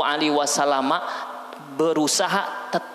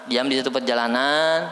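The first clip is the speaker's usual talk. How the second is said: normal talking voice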